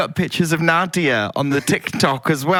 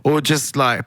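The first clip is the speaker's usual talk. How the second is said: ordinary delivery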